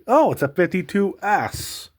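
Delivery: high, loud, drawn-out delight; excited discovery. Real, not acted. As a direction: exclaim over a lucky find, stretching it out. Sudden discovery of a good coin.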